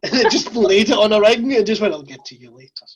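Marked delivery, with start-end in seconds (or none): 1.9-2.9 low, hushed aside; quiet promise. Retold or imitated. Bobby to the cake laid aside, hushed promise. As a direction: drop right down, as if saying it privately.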